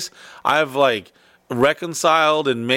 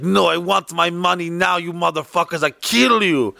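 badly faked Russian accent drifting toward Mexican; hammed-up foreign client, mock-furious Russian accent; mad Russian accent; 3 semitones higher than usual. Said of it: "maybe he turned Mexican in the middle".

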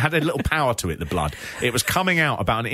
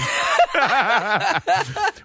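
laugh. Real, not acted. Laughing at absurd detail.

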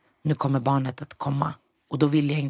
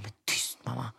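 quiet embarrassed hush; hushing sister; 4 semitones lower, 9 dB quieter than usual. Retold or imitated. Sister quietly hushing mom in the delivery room. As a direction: shush mom under your breath. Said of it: "Min syrra bara".

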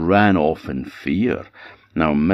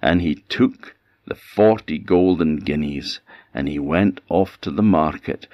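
plain reading voice

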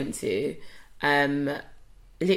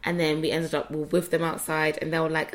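ordinary talk, nothing marked

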